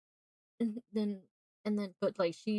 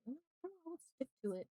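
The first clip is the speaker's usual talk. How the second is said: small, much softer voice; hesitant backing down; 8 semitones higher, 13 dB quieter, much faster than usual. Acted. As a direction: back out of it very quietly. Acts her backing out.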